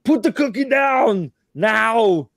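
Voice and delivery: high, slow, loud Arnold bark. Retold or imitated. Host playfully scolds in an Arnold voice, loud and slow. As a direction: bark it like Schwarzenegger. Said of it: "Yes, I know".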